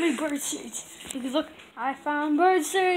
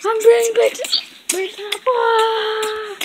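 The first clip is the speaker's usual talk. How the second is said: high, very slow silly voice; high character voice; 6 semitones higher, much slower than usual. Acted. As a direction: stretch it out high and goofy. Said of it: "all of my voices are changing".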